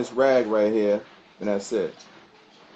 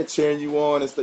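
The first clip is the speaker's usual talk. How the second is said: normal talking voice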